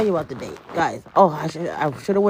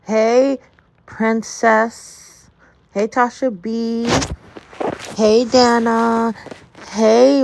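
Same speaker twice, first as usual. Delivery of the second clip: sing-song greetings; 6 semitones higher, much slower than usual. Real, not acted. Greeting viewers joining live.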